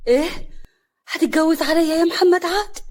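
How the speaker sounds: high, loud incredulous question; stunned, outraged asking; shocked. A narrator's reading. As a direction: raise pitch and volume in disbelief. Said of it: "هنا بصدمه".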